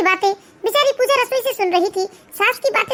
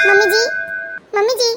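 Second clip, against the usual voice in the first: calling out. Acted. Sick bahu calling mother-in-law.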